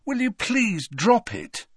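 slow, high-pitched weary plea; groaning, fed-up request; exasperated groan. A narrator's reading. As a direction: beg in drawn-out exasperation. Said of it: "he groaned".

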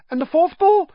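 put-on a starstruck little boy bursting to show his photo; eager young boy Colin. Colin pestering Harry.

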